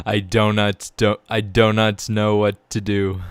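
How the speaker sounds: loud slow hopeless emphasis; exasperated emphasis. Real, not acted. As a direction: spell out the despair. Laughing despair at Blender.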